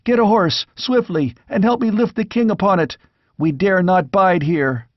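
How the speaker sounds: high, hurried command; urgent order. A narrator's reading. Squire orders the men-at-arms to save the king, urgent high command. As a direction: rush the men into action. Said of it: "shouted to the men-at-arms".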